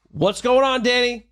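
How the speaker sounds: high, friendly call-out by name; greeting across room. Acted. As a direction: hail a gym member from across the mat. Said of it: "from anywhere in the room".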